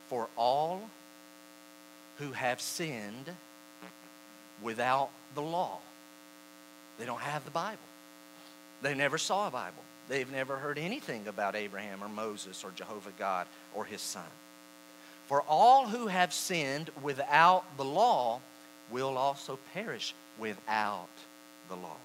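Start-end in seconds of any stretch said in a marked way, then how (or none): 0.1-5.8 slow scripture reading, long pauses; deliberate, widely spaced phrases. Shown as a demonstration. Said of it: "I'm gonna read it slowly". 15.3-22.0 slow, deliberate scripture reading; measured phrases with pauses. Shown as a demonstration. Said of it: "I'm gonna read it slowly".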